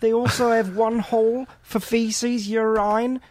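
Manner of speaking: put-on high, silly Aussie accent; a goofy Australian voice. Australian character keeps talking.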